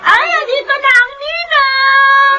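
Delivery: high, slow sing-song arrival call; excited greeting. Acted. Godmother calling out her arrival at the party, excited. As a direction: announce yourself cheerfully at the door.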